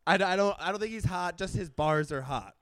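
ordinary talk, nothing marked

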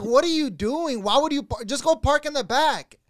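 high, loud retold exasperation; exasperated scolding. Telling Angelo off for parking out front, exasperated. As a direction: act out telling off a friend in disbelief.

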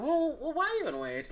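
soft, casual retold question; lightly acted indifference; imitating nonchalance. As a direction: shrug it off quietly, as if unbothered. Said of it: "nonchalant about it".

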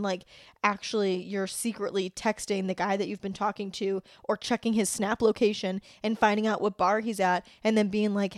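usual speaking voice

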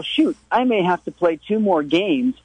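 ordinary delivery

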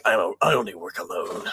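lightly voiced low gruff kid; a hint of a brooding boy trying to sound tough; deep voiced child. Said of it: "And Robin's like".

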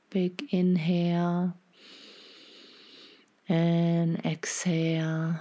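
half-whispered, unhurried guidance; guided meditation. Acted.

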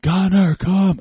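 high, loud alarmed yell to a dog; urgent retold holler. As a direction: shout the dog back, freaked out. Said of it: "I started to raise my voice".

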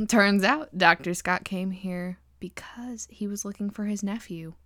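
normal talking voice

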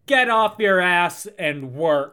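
loud, slow, drawn-out catchphrase; Kim Kardashian. Acted. Quoting a celebrity's favorite saying, loud and drawn out. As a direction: stretch out the command, loudly imitating a celebrity. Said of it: "then she was gonna say".

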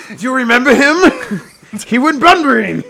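hammed-up outburst, half laughing; loud mock exclaim. Hamming it up at his friend about Bunbury, loud and laughing.

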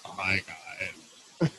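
slow, low, drawn-out "oh my God" imitating Fran; mock deep voice. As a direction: drop the voice way down and stretch it out. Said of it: "lower your vocal register and just talk like this".

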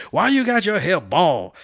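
imitating a drill sergeant, slowed down; mock drill sergeant. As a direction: play the bald drill sergeant needling a recruit. Drill sergeant scolding at barbershop.

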